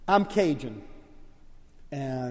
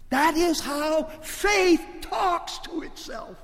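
high, loud, slow preaching cry; full-force preaching; 13 semitones higher, 12 dB louder, slower than usual. Acted. Preacher showing how faith talks, full-force cry. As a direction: cry it out over the whole church.

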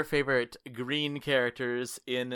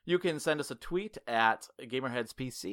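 normal talking voice

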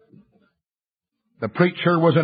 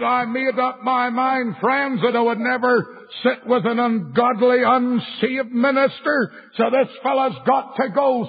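high, ringing pulpit delivery; impassioned preaching; 7 semitones higher than usual. Retold or imitated. Evangelist declaring against the unsaved minister, high preaching retold. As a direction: declaim it high to the whole congregation.

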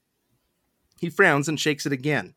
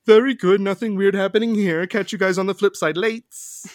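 higher, louder, carefree utopian dude voice; breezy future slang; 5 semitones higher, 4 dB louder than usual. Acted. Utopian shrugs off the torture room, breezy future slang. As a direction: toss it off like a laid-back futurist. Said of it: "Future speak".

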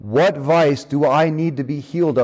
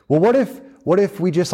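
high, wheedling what if; a lightly voiced old man haggling; bargaining; 5 semitones higher than usual. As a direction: try to wriggle out of it.

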